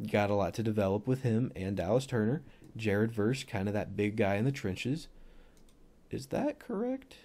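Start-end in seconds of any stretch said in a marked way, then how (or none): 6.1-7.1 suddenly much higher-pitched question; voice gone high. Real, not acted. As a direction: let the voice shoot up high, softly questioning. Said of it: "my voice went so high".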